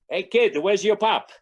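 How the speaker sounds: put-on high, loud punchline in Italian accent. Bacigalupi confronting the tiny statue, loud Italian accent. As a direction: demand it as a comic Italian tough guy. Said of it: "it's a little Italian guy".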